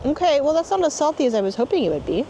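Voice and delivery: talking with gritty sand in mouth. Real, not acted. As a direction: speak around sand in the mouth. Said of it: "I got sand in my mouth".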